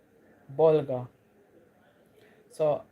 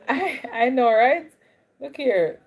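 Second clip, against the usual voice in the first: excited laughing agreement; 7 semitones higher, 7 dB louder, slower than usual. Real, not acted.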